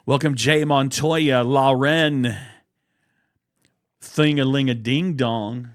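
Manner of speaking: loud showman greeting to the crew; hearty host welcome. Acted. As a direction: boom it out like an emcee.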